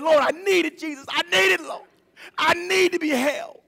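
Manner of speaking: high, imploring prayer; pleading cry. Retold or imitated. Son crying out to the Lord for comfort, high pleading. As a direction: cry out to God in need. Retold cry to God for help.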